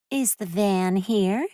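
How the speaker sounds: high, slow, starry-eyed questions; a renter fishing for gossip, high and dreamy; eager young woman. A narrator's reading. Woman asking the narrator about the man, high and eager.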